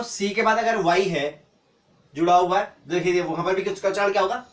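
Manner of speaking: normal talking voice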